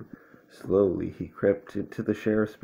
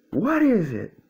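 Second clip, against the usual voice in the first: high, loud irritated snap; snapped; 11 semitones higher, 6 dB louder than usual. A narrator's reading. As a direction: snap back sharply as Owl. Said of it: "snapped Owl".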